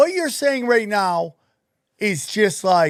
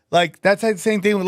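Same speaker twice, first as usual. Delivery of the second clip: usual speaking voice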